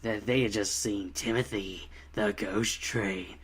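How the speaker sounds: overdone horror narrator, low and hushed; mock spooky narration, dropping low and drawing out the end; [name]. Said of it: "overdo the narrator".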